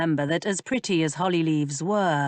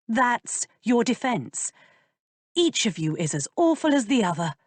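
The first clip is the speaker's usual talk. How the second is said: put-on higher, scolding young woman; lightly voiced lady, shocked and reproachful; indignant young lady; 6 semitones higher than usual. A narrator's reading. Georgiana scolds Benedict.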